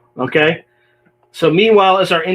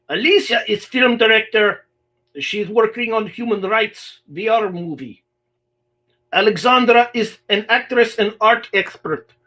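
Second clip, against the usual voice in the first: higher-pitched, badly faked Russian accent; terrible Russian accent; 6 semitones higher than usual. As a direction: read it in a hammy, clumsy Russian accent. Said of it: "That's a terrible Russian accent".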